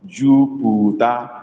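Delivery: very slow Jupiter, rising; slow model word. Shown as a demonstration. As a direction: draw out Jupiter for the class. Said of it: "the meaning of this word".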